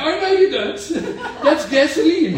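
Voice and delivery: high, loud cry of protest. Acted. Doctor tasting gasoline again, crying out loud. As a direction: yelp it out in mock outrage. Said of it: "Doctor Young cries out".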